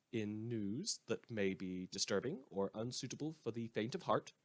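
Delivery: ordinary talk, nothing marked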